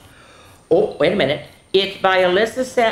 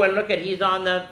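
usual speaking voice

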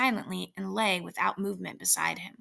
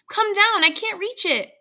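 very high, loud shout up the stairs; loud cry; 12 semitones higher, 9 dB louder than usual. Acted. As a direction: call to her husband above. Calling upstairs to husband.

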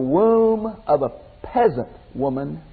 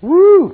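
high loud startled woo; mock-amazed woo; surprised woo; 13 semitones higher, 7 dB louder than usual. Real, not acted. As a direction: let out a whoop at the puzzle. Exclaiming at hard question.

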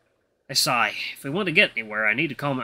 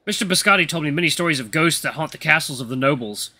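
ordinary delivery, nothing marked

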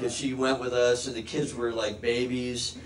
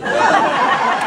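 breathy laugh; 11 dB louder than usual. Real, not acted. Laughing breath mid-line.

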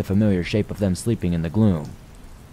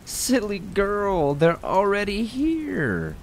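very high, slow, warped toy-rabbit voice; a killer plush rabbit telling the girl her parents are dead, warped and delighted; 13 semitones higher, slower than usual. A narrator's reading. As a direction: let a sweet monster gloat over her. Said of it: "In a warped, delighted voice".

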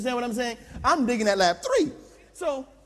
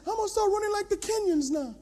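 trainer's voice; 12 semitones higher, faster than usual. Acted. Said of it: "he says".

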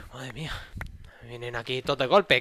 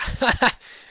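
high laugh; 11 semitones higher, 9 dB louder than usual. Real, not acted. After a hit.

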